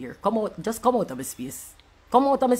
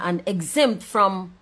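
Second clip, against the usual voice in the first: ordinary delivery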